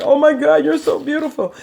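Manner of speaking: mock-tearful amazement at his wife; husband in awe. Husband seeing his made-up wife, lightly imitated awe. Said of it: "my husband started crying, like".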